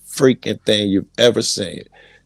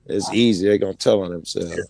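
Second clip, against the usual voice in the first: ordinary talk, nothing marked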